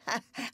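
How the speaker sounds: short, odd, high laugh; a brief mirthless little laugh; small strange laugh. A narrator's reading. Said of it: "her small, strange laugh".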